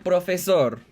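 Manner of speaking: "profesor" with Spanish vowels and stress on the last syllable; Spanish pronunciation. Shown as a demonstration. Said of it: "in Spanish".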